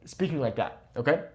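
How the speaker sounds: normal talking voice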